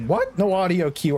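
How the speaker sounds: sudden high disbelief; surprised. Real, not acted. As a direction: caught off guard, pitch jumps up. Surprised at missing game sound.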